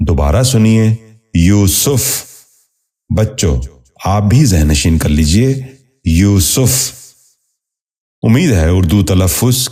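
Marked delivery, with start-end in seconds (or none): 1.3-2.2 یوسف said as "yoo-suf", short u after s; correct pronunciation. Shown as a demonstration. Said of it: "درست تلفظ ہے". 6.0-6.9 یوسف said as "yoo-suf", short u after s; correct pronunciation. Shown as a demonstration. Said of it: "درست تلفظ ہے".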